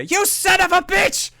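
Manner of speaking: high furious shout from behind the curtain; angry yell. Acted. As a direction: scream the insult in outrage. Alternate self bursts in yelling.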